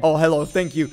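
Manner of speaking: ordinary talk, nothing marked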